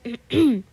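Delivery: a short, loud throat clear to cut in. Acted. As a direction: clear the throat pointedly to interrupt. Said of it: "till Ray clears his throat".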